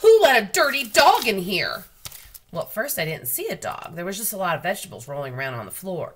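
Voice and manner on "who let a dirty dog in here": furious manager shouting across the store; slow angry shout. A narrator's reading. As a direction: bellow it slowly, much louder and higher. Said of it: "he kept shouting".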